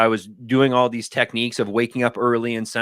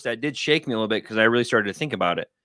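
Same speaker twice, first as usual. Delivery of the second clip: usual speaking voice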